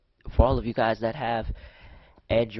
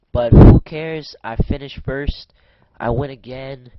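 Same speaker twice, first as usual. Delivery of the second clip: triumphant; 3 semitones higher, 11 dB louder, slower than usual. Real, not acted. Triumphant at the finish.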